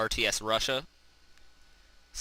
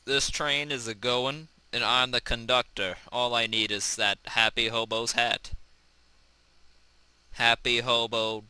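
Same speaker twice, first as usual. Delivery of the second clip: ordinary delivery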